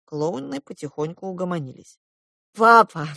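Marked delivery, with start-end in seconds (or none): 2.5-3.1 solemn address, louder and higher. A narrator's reading. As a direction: call Dad to account solemnly. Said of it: "торжественно сказала я". (торжественно)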